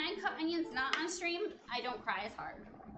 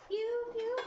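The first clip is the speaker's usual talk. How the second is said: higher, louder toy blaster sound; playful pew pew; 8 semitones higher, 4 dB louder than usual. Pretend. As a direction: zap it like a toy laser gun. Playful sound effect while cooking.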